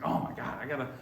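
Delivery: mock exasperated outburst, rising on the complaint. Hiker with a stone in the shoe, mock exasperated. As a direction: act out mild, worked-up annoyance. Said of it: "this is driving me crazy".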